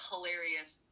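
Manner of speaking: ordinary talk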